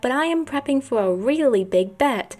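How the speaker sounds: put-on Boy Boy Mysterio voice. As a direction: play a schemer boasting in a swooping voice. Voicing Boy Boy Mysterio's lines.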